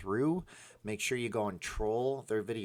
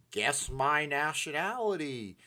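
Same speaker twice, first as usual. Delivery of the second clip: slow, higher teasing host voice; mock game-show voice; 6 semitones higher, 4 dB louder, slower than usual. Imagining a fifty-thousand-sub stunt, slow teasing quiz voice. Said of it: "and I'll be like".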